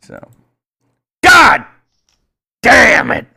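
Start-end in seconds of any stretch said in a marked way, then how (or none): 1.2-3.3 loud, high outburst; explosive frustrated cursing; exasperated shout. Acted. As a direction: bellow each word separately, pausing between. Shouted cap on his rant.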